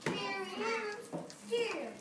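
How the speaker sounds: slow, hushed read of the title, drawn out; storybook title reading. Acted. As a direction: present the cover slowly, almost breathless. Reads title to children.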